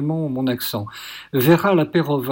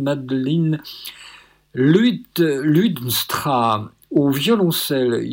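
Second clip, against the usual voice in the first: ordinary talk, nothing marked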